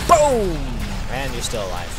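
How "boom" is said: high, loud falling boom; big playful blast; exclaimed sound effect. Pretend. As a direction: shout it like an explosion. Voicing a hit in game.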